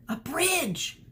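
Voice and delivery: high excited exclamation; excited realization. Acted. Sheeran seeing the plan, excited high exclamation. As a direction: blurt out the idea.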